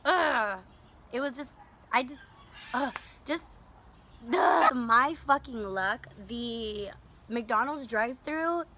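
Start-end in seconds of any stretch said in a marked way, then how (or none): 4.3-4.8 disgusted ugh. Real, not acted. Grossed out by moist money.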